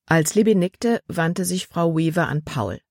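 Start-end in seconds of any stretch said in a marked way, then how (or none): none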